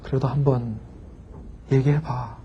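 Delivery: soft, low coaxing; gentle urging. Retold or imitated. Professor softly urging the student to speak, low and gentle. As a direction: coax him softly to go on.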